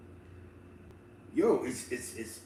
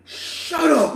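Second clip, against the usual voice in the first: mock yell; 12 semitones higher, 3 dB louder than usual. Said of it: "He was like".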